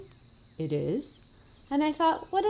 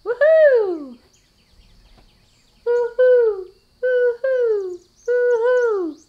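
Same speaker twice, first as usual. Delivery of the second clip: loud high woohoos; loud cheering; 10 semitones higher, 8 dB louder, slower than usual. Pretend. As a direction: cheer at the top of your lungs. Repeated cheering at the top.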